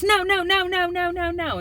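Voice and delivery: put-on high, whiny little-girl voice; imitating whiny daughter. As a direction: mimic a kid begging for candy. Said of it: "And then she goes".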